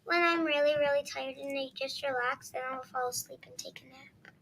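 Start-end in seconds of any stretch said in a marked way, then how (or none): 1.1-4.3 whispering. Real, not acted. Child voice drops to whisper.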